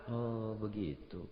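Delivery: low, slow, hushed; muted slow reply; quiet acknowledgement. Retold or imitated. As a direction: take it in softly, slowly. Umar hiding identity, quiet.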